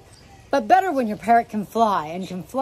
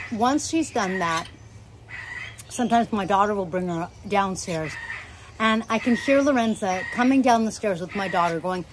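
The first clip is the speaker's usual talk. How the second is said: usual speaking voice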